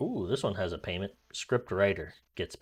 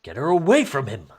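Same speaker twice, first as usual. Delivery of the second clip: loud, higher urgent order; urgent command; 5 semitones higher, 10 dB louder than usual. Acted. Audition line read.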